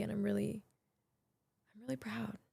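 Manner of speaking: real emotion, voice dropping quietly; fighting back tears as the pride sinks in; choked up. Real, not acted. Proud host getting choked up over her podcast, softly emotional. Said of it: "I'm getting emotional".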